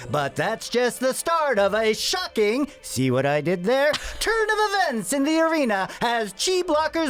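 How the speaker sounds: put-on old-time sportscaster. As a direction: call it like a twenties radio sportscaster. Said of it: "in the style of [name]'s sportscaster voice".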